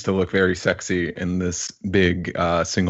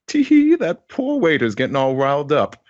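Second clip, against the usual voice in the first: high, giggly, dainty lady voice; Sovereign; 8 semitones higher, 4 dB louder than usual. Acted. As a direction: coo it like an amused noblewoman, higher and a bit louder. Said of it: "Sovereign is like".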